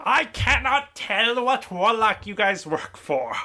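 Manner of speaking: slow, high skeleton voice; clattering jaw cackling skull. Acted. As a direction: rattle it out like a skull. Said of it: "I'm trying to find a more skeleton voice".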